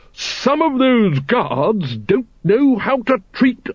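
a hearty aristocrat, drawn-out and lofty; Lady Ramkin's voice. A narrator's reading. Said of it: "she began".

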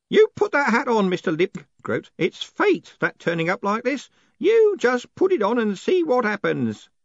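put-on high, pushy old man's voice; eager old Groat. Groat urges Moist to put the hat on, high and eager. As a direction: press him hard to put the hat on.

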